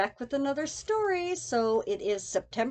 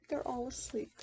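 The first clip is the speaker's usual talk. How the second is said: very soft hushed whisper; 3 semitones lower, 12 dB quieter, faster than usual. A narrator's reading. Reading bedtime story to child, whispered. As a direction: hush it so no one wakes.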